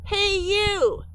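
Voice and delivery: high, loud call across the pond; shout. Acted. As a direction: call out to the beavers. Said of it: "he shouted".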